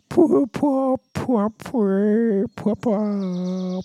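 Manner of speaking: high, robotic vocoder warble on pwah; vocoder imitation. Bon Iver answering the chicks, mock vocoder warble. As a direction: mimic an autotuned vocoder note, pitched high. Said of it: "like with the fucking vocoder".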